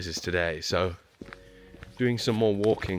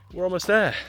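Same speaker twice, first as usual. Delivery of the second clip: imitating a chirpy hiker, much higher and louder; mock cheerful hiker; 10 semitones higher, 6 dB louder than usual. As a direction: play the lying, cheery trail buddy. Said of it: "and someone says".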